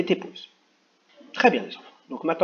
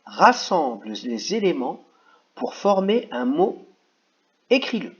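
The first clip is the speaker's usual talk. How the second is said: "Rassemble les éléments pour former un mot, écris-le" read slowly, rising higher and louder at the end; slow dictation; 4 semitones higher, 3 dB louder than usual. Shown as a demonstration. Teacher reading the instruction aloud to children, slowly. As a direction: dictate each word slowly so children can follow. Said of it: "je vais juste vous dire".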